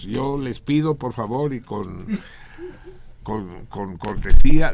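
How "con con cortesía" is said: sudden loud, slow "cortesía" shouted at the crew; mock courteous shout. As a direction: bellow the politeness, dragging it out. Pleading staff not to cut.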